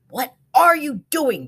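loud, high demand; loud demanding voice from below. A narrator's reading. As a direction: demand an answer sharply. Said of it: "demanded one voice".

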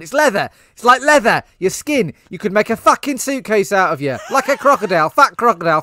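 loud, high, furious London gangster rant; angry London accent. Acted. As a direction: hurl insults in a London accent, building to shouting. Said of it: "Are we gonna do the accent?".

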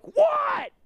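sudden high yell of alarm; startled shout. Retold or imitated. Dad at the wheel jolted by a shriek, shouts high. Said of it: "I'm like".

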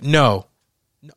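normal talking voice

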